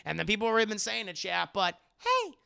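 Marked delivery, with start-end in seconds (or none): 2.0-2.3 high, piercing attention call; Navi. Retold or imitated. Streamer mimicking a game helper for chat, high insistent call. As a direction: pipe up like a nagging game helper. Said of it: "from Navi".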